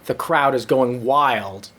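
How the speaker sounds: ordinary talk, nothing marked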